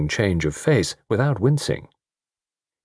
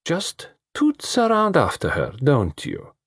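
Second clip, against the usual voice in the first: slow high teasing; 7 semitones higher, slower than usual. A narrator's reading.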